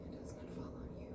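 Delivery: soft breathy whispered reassurance. Shown as a demonstration. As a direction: whisper it like a secret comfort. Repeats line in whisper then plain.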